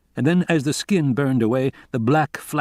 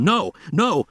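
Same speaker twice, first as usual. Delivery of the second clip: shouting; 7 semitones higher than usual. A narrator's reading. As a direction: yell to stop the men.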